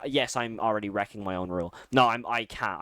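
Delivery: usual speaking voice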